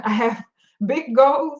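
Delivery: ordinary talk, nothing marked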